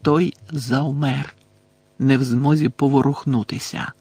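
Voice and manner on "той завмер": ordinary delivery, nothing marked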